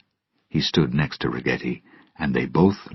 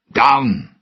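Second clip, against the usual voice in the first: higher, louder repeat of the command; calling down; 7 semitones higher, 4 dB louder than usual. Acted. Captain ordering his men down with a louder call. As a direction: shout the order again, harder.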